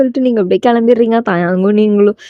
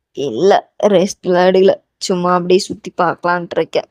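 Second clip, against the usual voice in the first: lower-pitched character voice; voiced male lead; 4 semitones lower than usual. Voicing Taeyang's replies in dialogue.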